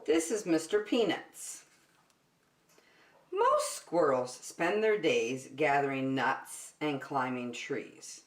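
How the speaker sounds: plain reading voice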